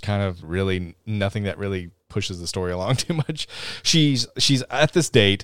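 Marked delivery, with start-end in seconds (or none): none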